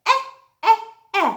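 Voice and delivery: "eh" as the short e in elephant, repeated; short e sound. Shown as a demonstration. Teacher sounding out the letter E to the class, short eh. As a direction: clip out "eh" three times. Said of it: "E says".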